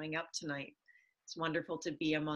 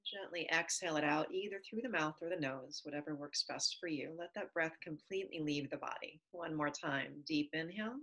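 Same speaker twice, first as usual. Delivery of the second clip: guided breathing; 4 dB quieter than usual. Acted. Leads a breathing exercise.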